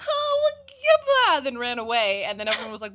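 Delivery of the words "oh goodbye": high, loud, drawn-out panicked cry; hammed-up panic before fleeing; mock freak-out. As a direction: imitate someone freaking out, stretched and loud. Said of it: "then she freaked out".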